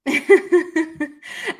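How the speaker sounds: helpless chuckling at the absurdity; real laugh. Real, not acted. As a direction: laugh out loud in amusement. Laughs at odd word order.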